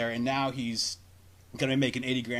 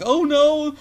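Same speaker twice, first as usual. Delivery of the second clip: high, loud imitation of a panicked mom; mock panic; 12 semitones higher, 8 dB louder than usual. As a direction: play the mom losing her nerve. Panicking mom voiced in story.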